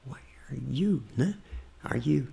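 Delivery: very soft practice fragments; intimate voice. Shown as a demonstration. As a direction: try the words out under your breath. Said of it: "voz íntima". (voz íntima, bem baixinho)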